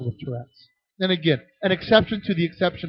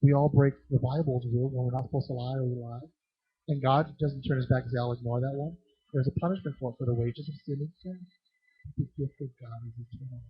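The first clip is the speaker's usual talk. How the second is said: quiet counsel; 3 semitones lower, 5 dB quieter than usual. Retold or imitated. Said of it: "a great opportunity to say".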